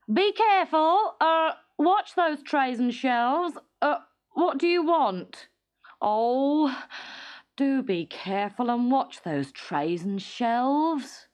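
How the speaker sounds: put-on high, fretful old shopkeeper; nervous shopkeeper. A narrator's reading. Shopkeeper fretting as clumsy Teddy walks in, nervous. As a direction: fuss anxiously over the shelves.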